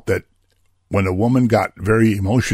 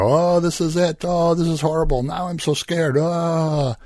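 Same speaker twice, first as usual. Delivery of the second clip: higher, hammed-up frightened wail; mock panic; 8 semitones higher than usual. Believer hearing coronavirus news on TV, mock-frightened high wail. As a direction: play a panicky believer, half-mocking. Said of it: "throw our arms up and go".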